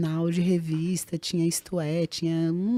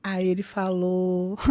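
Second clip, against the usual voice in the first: ordinary talk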